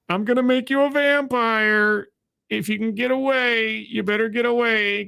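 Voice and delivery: high, droning ancient creature whine; weary mummy god. Acted. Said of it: "And the guy's like".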